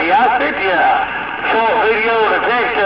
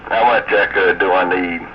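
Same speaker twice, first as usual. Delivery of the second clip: cave echo; 12 semitones lower than usual. Real, not acted. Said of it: "Sounds like you're in a cave there".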